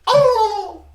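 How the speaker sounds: high, loud turkey gobble; gobbling turkey voice. Retold or imitated. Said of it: "That's how they sound".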